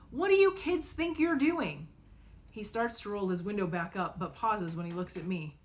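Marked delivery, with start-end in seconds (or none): 0.1-1.9 loud, high angry shout; angry yell. Acted. Driver yelling at kids in the road, angry. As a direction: bark it at the kids. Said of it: "rolls down his window and yells".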